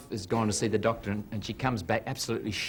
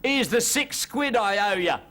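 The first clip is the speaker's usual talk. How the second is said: high, loud, slow Cockney pay-off; Cockney punchline; 11 semitones higher, 6 dB louder, slower than usual. Acted. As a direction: deliver the pun slowly. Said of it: "His friend on the surface says".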